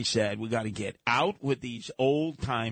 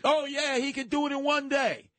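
mocking gullible fans; 12 semitones higher than usual. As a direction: parody a true believer swallowing the claim. Mocking Trump's believers.

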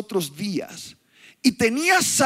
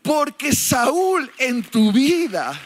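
fiery preaching; 4 semitones higher than usual. Acted. Rising preaching finale.

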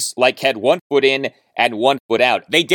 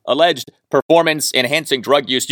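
normal talking voice

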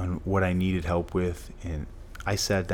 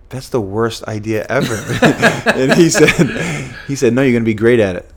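loud, laughter breaking into the words; laughing while telling; 3 semitones higher, 11 dB louder than usual. Real, not acted. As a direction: retell it through a real laugh. Laughing as he retells the exchange.

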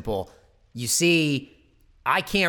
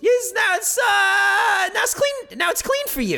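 mock angry yell. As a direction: shout it back at him, much higher. [name] shouting back about the glove.

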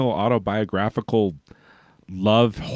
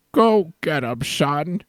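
raised, lightly voiced old grandma; 7 semitones higher, slower than usual. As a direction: egg him on like a proud granny. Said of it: "I do, like, a whole grandma voice".

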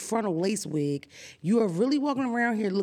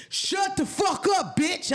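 higher, slow shouted outburst, as Will really would; imitated furious shout; 8 semitones higher, slower than usual. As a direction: let it rip like the real Will underneath. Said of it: "I know what you really wanna say".

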